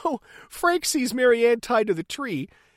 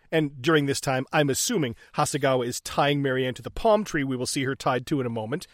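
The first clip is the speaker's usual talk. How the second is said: usual speaking voice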